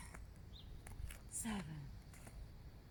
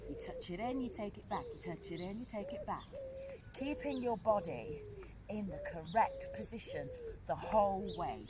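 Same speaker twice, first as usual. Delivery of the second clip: normal talking voice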